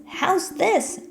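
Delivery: high eager question; Toby checking. A narrator's reading. Toby asking the frog about his reach, high eager question.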